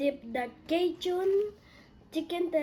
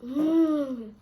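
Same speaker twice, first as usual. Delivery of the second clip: yummy mmm; 8 dB louder than usual. Real, not acted. Tasting the chicken.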